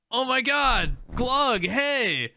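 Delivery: high, delighted recognition; excited greeting. Retold or imitated. Players spotting Glug across the map, excited greeting. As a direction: call out like spotting an old friend.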